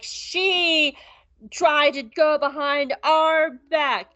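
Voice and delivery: slow, dragged-out accusation; hammed-up slow grievance; mock tattling complaint. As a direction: drag every word like a tattletale. Acted whiny accusation in recap.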